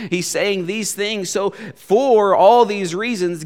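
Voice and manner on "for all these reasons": FOR leaned on, loud and drawn out; slowly, landing hard on the word For; stress on FOR. Shown as a demonstration. Preacher showing how to emphasize a different word, stress on For. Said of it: "each time emphasizing a different word".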